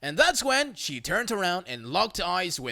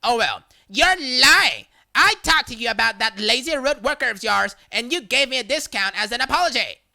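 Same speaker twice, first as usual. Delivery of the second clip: angry accusation; 4 semitones higher, 5 dB louder than usual. Retold or imitated. Customer accusing the narrator of lying, loud and angry. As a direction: call him a liar loudly.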